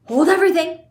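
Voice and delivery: high, loud command; commanding call. A narrator's reading. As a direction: halt the room. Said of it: "said Mister Wonka".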